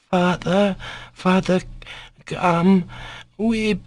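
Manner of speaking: put-on high, broken-up child's voice; faltering with excitement. A narrator's reading. Sick boy calls to his father, faltering excitedly. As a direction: halt between words, too worked up. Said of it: "Ilusha faltered in violent excitement".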